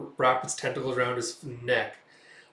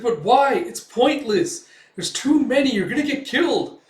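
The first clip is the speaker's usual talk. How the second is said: high, loud, alarmed pleading; desperate protest; 11 semitones higher, 9 dB louder than usual. Retold or imitated. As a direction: protest as if lives depend on it. Pleads with Guts to flee.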